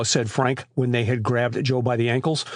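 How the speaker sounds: plain reading voice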